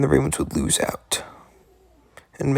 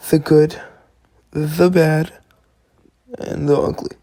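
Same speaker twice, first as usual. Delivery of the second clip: normal talking voice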